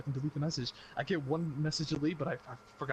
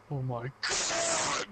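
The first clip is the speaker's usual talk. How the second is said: higher, slow groaning disbelief; slow exasperated mom; 12 semitones higher, much slower than usual. Retold or imitated. Mom exasperated at son's confession, high slow. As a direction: sigh it out like a worn-out mother.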